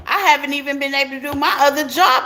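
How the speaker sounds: ordinary talk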